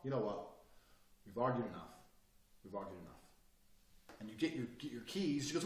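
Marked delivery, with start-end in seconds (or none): 0.0-3.1 low, soft finality; sensible and calm. Acted. Man ending an argument with his girl, calm. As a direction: drop the volume and end it calmly. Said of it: "you being sensible and calm and nice".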